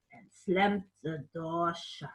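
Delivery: usual speaking voice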